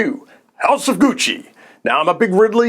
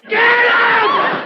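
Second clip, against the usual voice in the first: shout. Real, not acted. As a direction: throw it out as a yell. Ranting reviewer shouts dismissal.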